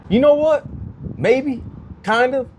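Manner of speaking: high-pitched, halting maybe; tentative hedging. Retold or imitated. Novice actor answering the director about experience, high tentative hedging. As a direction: squeak out a hesitant reconsideration.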